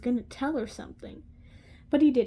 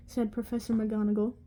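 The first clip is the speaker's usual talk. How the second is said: ordinary delivery, nothing marked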